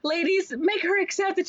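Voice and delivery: high hammed-up order to the ladies; rally the ladies theatrically; playful pitchman egging them on; mock commanding salesman.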